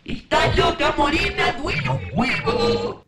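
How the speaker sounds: loud mock warning; warning friends. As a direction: shout it like worried friends. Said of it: "la gente me decía". (amigos advirtiendo a los gritos)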